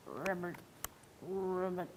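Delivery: soft low ribbit croak; frog croak. Shown as a demonstration. Teacher showing children a frog croak, low and soft. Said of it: "Can you sound like a frog?".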